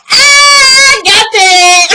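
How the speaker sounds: loud, high gleeful yell; gleeful outburst. Real, not acted. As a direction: crow over a burn. Crowing over the retold exchange.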